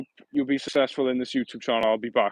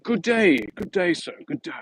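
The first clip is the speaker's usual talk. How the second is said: higher, hammed-up London greeting; lightly put-on Londoner saying good day; mock London accent; 5 semitones higher than usual. Teasing a chat viewer from London with a mock London accent. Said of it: "He's from London".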